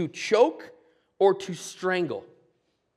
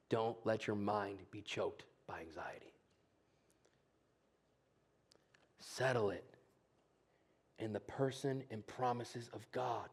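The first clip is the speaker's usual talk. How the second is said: soft, breathy near-whisper, barely voiced; hushed whisper; 6 semitones lower, 16 dB quieter than usual. Preacher whispering comfort to worried listeners. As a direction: lean in and whisper it to the congregation. Intimate close of sermon point.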